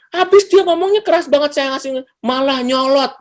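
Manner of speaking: high-pitched acted grumble; irritated complaint. Someone justifying anger at a loud talker, high indignant complaint.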